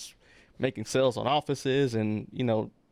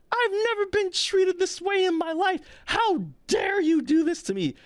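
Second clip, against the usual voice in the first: much higher, louder imitation of an indignant woman yelling; mock outraged shout; 3 dB louder than usual. Indignant visitor being escorted out, mimicked outraged yell. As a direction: play her as offended and shouting at the desk. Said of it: "she gets so mad".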